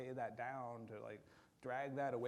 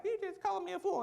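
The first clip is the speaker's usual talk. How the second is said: put-on much higher indignant child voice; offended kid; 5 dB louder than usual. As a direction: whine back like a hurt kid. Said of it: "they're like".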